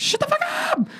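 very high squeaky shout; squeaky yell. Acted. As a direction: shriek it up high. Playful shout of the catchphrase.

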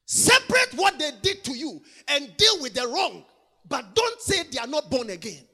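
loud, driving preacher cadence; full-force preaching. Acted. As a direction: hammer the point home to the congregation.

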